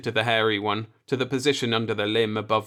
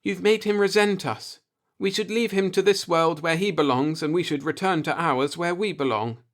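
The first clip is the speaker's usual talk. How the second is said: higher, tense and uneasy; a touch of fright in a higher voice; frightened; 6 semitones higher than usual. A narrator's reading. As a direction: plead to leave, scared. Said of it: "Marla was frightened now".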